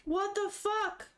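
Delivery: drawn-out, high-pitched disbelief; slow, baffled astonishment; stunned disbelief. Real, not acted. Reader reacts to shocking plot twist.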